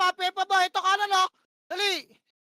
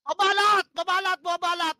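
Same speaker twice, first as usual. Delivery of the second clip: loud, urgent callout, a little higher; shouted command; 3 semitones higher, 7 dB louder than usual. Real, not acted. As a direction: bark the order to the whole team. Calling out commands mid-fight.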